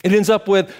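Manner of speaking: ordinary delivery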